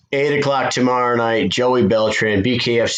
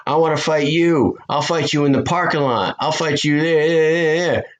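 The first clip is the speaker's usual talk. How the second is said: higher-pitched mock trash-talk challenge; Ronda Rousey callout; 6 semitones higher than usual. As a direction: call her out like a fighter after a title win.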